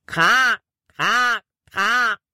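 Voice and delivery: convincing crow caw imitation; crow call. A narrator's reading. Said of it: "Das klang so echt".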